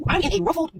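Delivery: high, incredulous and exasperated; confused as heck. Retold or imitated. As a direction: sound baffled at being treated as a threat. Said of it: "I'm talking to him literally like this, confused as heck".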